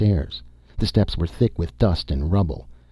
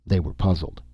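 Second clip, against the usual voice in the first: ordinary delivery, nothing marked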